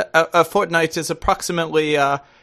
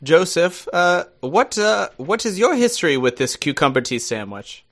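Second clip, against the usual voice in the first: put-on posh-phrased British accent. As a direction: play the very British guest, drawing out the formal phrasing. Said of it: "as enjoyable as this accent is".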